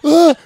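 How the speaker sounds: seeing a gun pointed at you; startled yelp. Retold or imitated. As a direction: jump at the sight of a gun. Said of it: "I'm like".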